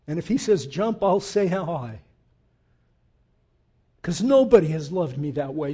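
ordinary delivery

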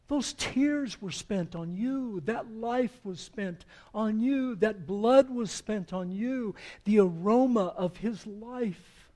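loud, slow, insistent pulpit voice; fervent sermon climax; impassioned preaching. Acted. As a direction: drive each line home to the listeners. Preaching the sacrifice.

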